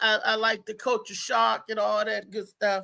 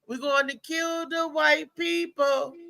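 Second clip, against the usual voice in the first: slow, higher mock-Jamaican chant; Eddie Murphy impression; 5 semitones higher, slower than usual. Recalling an old Saturday Night Live bit, drawn-out Jamaican impression. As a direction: draw it out in an imitated Jamaican lilt. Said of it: "He also said".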